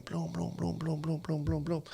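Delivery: soft rapid mouth-gunshot sounds; imitated gunshots. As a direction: mimic gunfire quietly.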